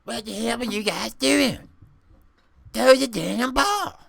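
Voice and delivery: loud, fast, exasperated yell at the players; mock shout. Chester yelling at his players to throw the ball, loud and fast. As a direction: bark at them to throw the ball.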